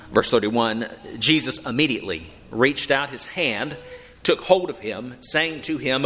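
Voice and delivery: normal talking voice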